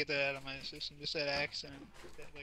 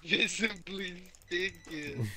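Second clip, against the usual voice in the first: higher, slow, drawn-out echo; mocking echo; 4 semitones higher, much slower than usual. Friend parrots the gamer's stinking remark, mock drawl. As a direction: stretch the words out in mock imitation.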